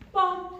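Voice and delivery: stationary blast. Shown as a demonstration. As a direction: imitate a blaring fire alarm. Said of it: "Fire alarms do that".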